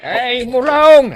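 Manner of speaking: loud, slow call across the yard; loud drawn-out scolding call; shouting a name. Acted. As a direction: bellow his name slowly, scolding. Mother shouts at son Mulong.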